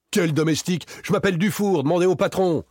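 high-pitched flustered protest; high, pressing self-defence; indignant denial. A narrator's reading. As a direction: insist on it, voice pitched well up. Victor indignantly denies his identity.

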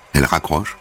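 plain reading voice

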